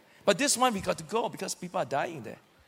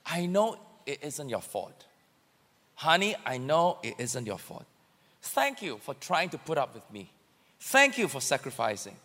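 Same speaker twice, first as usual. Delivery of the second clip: low, soft words to a spouse; acted, hushed gratitude; tender appreciation; 7 semitones lower, 5 dB quieter than usual. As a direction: make up gently, as if demonstrating.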